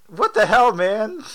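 high, loud shocked cry; shocked outburst. Real, not acted. Friend reacting to a gruesome movie death, shocked outburst. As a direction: blurt it out in disbelief.

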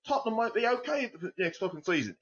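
high, hammed-up English accent, grudging hope; mock English accent. Podcaster mocking Tottenham's yearly cycle, in a hammy English accent. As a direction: play a jokey bad Englishman shrugging. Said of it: "in the same terrible English accent".

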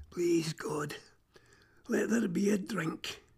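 hushed, desperate whisper; a crow's whispered prayer for water. Acted. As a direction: breathe the plea to God. Crow prays for water.

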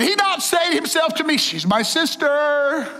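high, slow, defensive protest; pleading king. Retold or imitated. King pleads innocence before God, mild defensive protest. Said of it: "So he said".

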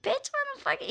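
high, startled bitch what the fuck; incredulous outburst. Retold or imitated. Reacting to people staring at her.

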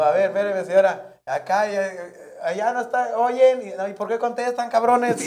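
teasing the crowd. Retold or imitated. The comic jokes back at hecklers in the palenque, loud high teasing. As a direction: rib the hecklers from the stage.

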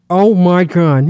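talked out of the side of the mouth; loud mock Boston oh my God; loud Boston out of the mouth corner; Boston accent. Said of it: "in the side of the mouth".

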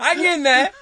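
loud, high, through laughter; laughing mock quote. Host mocking co-host's phrase as innuendo, laughing. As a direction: quote it teasingly while cracking up. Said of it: "that's what all the girls say".

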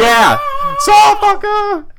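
very high, slow, loud shout; hammed-up rocker yell; mock metal yell. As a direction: scream it like a garage metal singer. Imitating metal singer.

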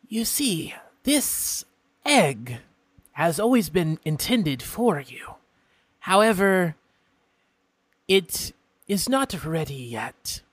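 a polite, curious imp stranger; imp folk voice. Acted. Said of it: "he continues".

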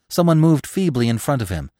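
usual speaking voice

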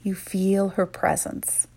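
calm, unhurried meditation voice; guided meditation. Acted. Guide leading women leaders through a visualization, calm and soothing. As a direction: lead them gently inward, pausing often.

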